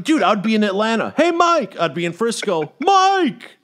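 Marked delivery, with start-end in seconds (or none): 1.2-1.8 calling out. Retold or imitated. Strangers calling him by mistake. 2.8-3.6 calling out. Retold or imitated. Strangers calling him by mistake.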